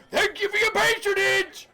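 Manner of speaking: high, loud, flustered innkeeper repeating himself; a harried host scrambling for a free table. Acted. Innkeeper thanking loudly.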